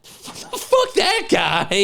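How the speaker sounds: high, slow outburst through laughter; real laughing disbelief; laughing dismissal. Real, not acted. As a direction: dismiss it while cracking up. Laughing outburst at obscure character.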